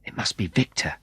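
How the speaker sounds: soft breathy whisper; whispered thought. Acted. Matt hides by the trees and thinks to himself, whispered. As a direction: whisper it to yourself.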